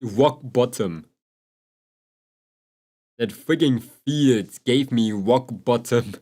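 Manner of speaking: slow, drawn-out mock dramatic delivery. Telling chat about the field, mock dramatic and slow. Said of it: "my freaking sad anime arc".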